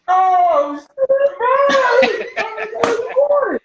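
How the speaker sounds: high, loud, overwhelmed exclamation; high shocked cry of disbelief; starstruck gasp. Retold or imitated. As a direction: blurt it out, totally starstruck. Retold starstruck reaction to Ramsay.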